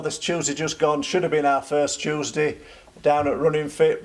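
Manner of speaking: normal talking voice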